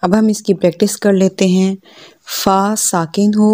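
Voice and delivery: normal talking voice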